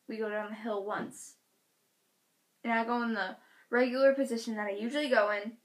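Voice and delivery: ordinary talk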